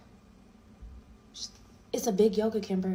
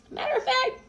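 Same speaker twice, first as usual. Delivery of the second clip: high outburst. Retold or imitated. Final outburst to ex.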